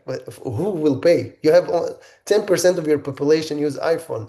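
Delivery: ordinary talk, nothing marked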